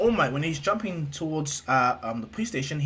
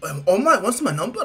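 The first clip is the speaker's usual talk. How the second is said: high, louder, amazed fanboy voice; Izuku; 6 semitones higher, 6 dB louder than usual. Acted. Boy stunned by his idol's request, high and loud. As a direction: blurt it in raised disbelief. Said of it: "Izuku's like".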